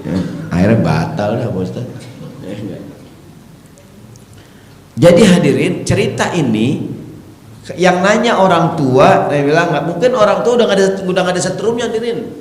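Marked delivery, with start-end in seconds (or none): 5.0-12.3 preaching at full force; fiery preaching. Acted. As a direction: rouse the congregation.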